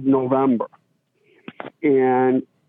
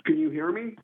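faint, distant caller; speaking away from the phone; phone held away; 8 dB quieter than usual. Real, not acted. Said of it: "Very faint".

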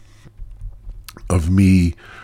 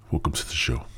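whisper; 3 semitones lower, 7 dB quieter, much faster than usual. Acted.

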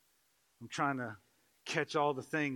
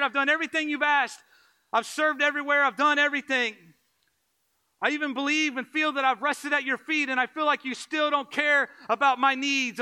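much higher and louder wailing complaint; loud whiny plea of "what about me"; self-pitying believer; 12 semitones higher, 13 dB louder than usual. Retold or imitated. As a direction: cry out to Jesus, aggrieved and demanding.

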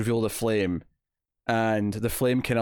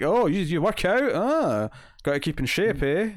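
mock-casual probing; 6 semitones higher, slower than usual. Gordon casually probing Dent's physique.

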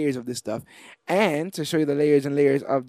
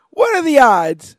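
loud, high, slow "what are the odds"; stunned and incredulous, stretched out; reliving the shock of spotting it; disbelief; 9 semitones higher, 9 dB louder than usual. Retold or imitated. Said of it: "I'm like".

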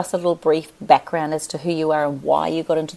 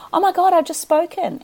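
shocked self; 12 semitones higher than usual. Retold or imitated. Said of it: "I went".

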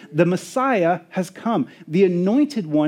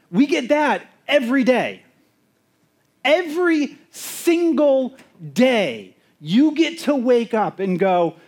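ordinary talk, nothing marked

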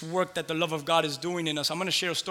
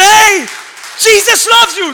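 high, loud call; loud proclamation; 16 dB louder, slower than usual. Retold or imitated. Pastor telling the driver Jesus loves her, loud. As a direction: announce it boldly out the window. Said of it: "I said".

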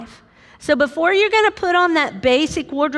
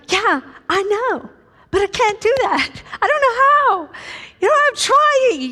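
high, whining excuse, rising to louder; whiny protest; 10 semitones higher, 3 dB louder than usual. Retold or imitated. Wife answering her husband, high whiny pleading. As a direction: plead helplessly that you can't. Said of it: "And you're like".

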